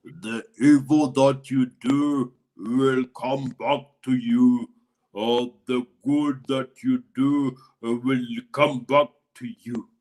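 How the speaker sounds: low, slow, deep voice of the hunchback; slow low prophetic pronouncement; solemn hunchback man. A narrator's reading. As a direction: intone it like a grave warning, slowly. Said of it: "looked at the woman and said".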